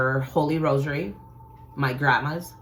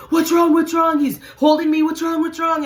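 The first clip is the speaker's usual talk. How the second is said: high, loud, worried dad; a father calling out in alarm; dad shouting in alarm at his scared child; frantic dad; 13 semitones higher, 8 dB louder than usual. Retold or imitated. Dad frantically asks the screaming child.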